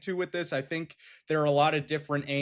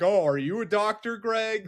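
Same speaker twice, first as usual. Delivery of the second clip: higher-pitched snippy mock question; 5 semitones higher than usual. Team president jabbing at a radio host, snippy. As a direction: imitate a snippy radio retort. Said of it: "kind of be a little bit snippy".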